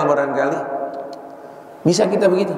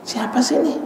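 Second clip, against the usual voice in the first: curious wife; 8 semitones higher than usual. Retold or imitated. Wife hears phone ring.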